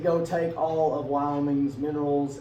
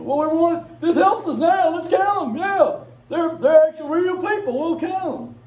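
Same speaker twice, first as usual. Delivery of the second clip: much higher and louder, pushy and excited; eager delegates; 14 semitones higher, 10 dB louder than usual. Retold or imitated. Southern delegates demanding extra representation, high and loud. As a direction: shout it like greedy delegates spotting an advantage. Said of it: "delegates answer, acted".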